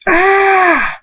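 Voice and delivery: long, very high acted scream. A narrator's reading. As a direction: scream out in agony in a story. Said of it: "Thunderstorm screams".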